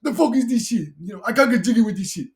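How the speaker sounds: high, loud mock African accent; imitated African accent. Quoting a funny scene from Friday, imitated African accent. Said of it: "It's because of his accent".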